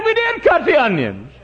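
high, loud waitress retort; waitress insisting to the customer; indignant waitress. Retold or imitated. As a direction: play the waitress digging in her heels. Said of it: "She said".

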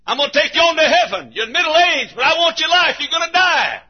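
usual speaking voice

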